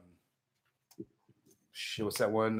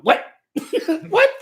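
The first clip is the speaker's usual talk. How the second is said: mock "What?" broken by laughs; laughing mockery; 5 dB louder than usual. Mocking the singer to brother, laughing. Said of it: "That's all I was doing".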